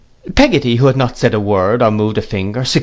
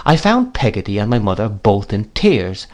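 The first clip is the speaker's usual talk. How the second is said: ordinary delivery, nothing marked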